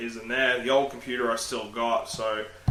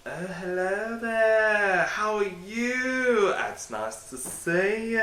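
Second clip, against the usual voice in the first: slow, higher mock polite greeting; greeting his reflection; 8 semitones higher, much slower than usual. Vlogger greeting his own reflection, slow mock polite. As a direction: coo at yourself in the mirror. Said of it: "be like".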